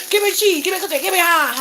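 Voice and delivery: high, loud mock kitchen holler; imitating busy cooks yelling orders; hollering coworkers. Restaurant coworkers hollering orders, high loud mock yell. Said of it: "Everybody be hollering".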